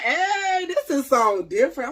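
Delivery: high, loud thrilled shout; excited shout. Retold or imitated. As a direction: yell it like a sudden happy discovery. Retold thrilled inner thought.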